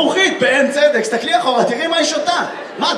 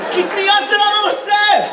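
shouted; 8 semitones higher than usual. Real, not acted. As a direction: shout the answer across the room. Shouting out a retort.

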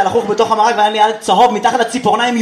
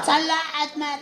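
put-on higher, softer old grandma; lightly voiced Yemenite grandmother; 6 semitones higher, 8 dB quieter, much slower than usual. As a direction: answer like a curt grandma.

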